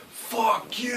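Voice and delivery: high, hushed mock scream; drawn-out, softened pretend scream. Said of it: "these people are screaming".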